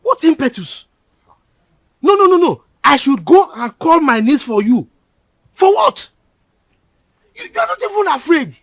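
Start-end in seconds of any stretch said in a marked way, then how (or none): 2.0-8.6 loud, high sarcastic tirade; louder, higher angry scorn; mocking yell. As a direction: scoff loudly at his nerve. Uncle mocking and yelling at boy.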